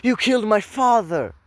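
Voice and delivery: loud, high accusing shout as Luke; Luke's cry. Retold or imitated. Luke shouts at Vader, a loud high accusation. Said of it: "when Luke's like".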